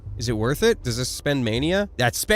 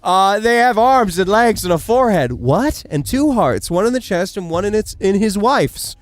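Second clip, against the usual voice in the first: voiced child; 6 semitones higher, 7 dB louder than usual. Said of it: "Terrible accent".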